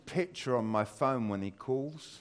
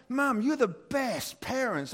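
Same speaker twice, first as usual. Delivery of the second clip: grateful son; 11 semitones higher, slower than usual. Retold or imitated. Son's grateful text quoted again.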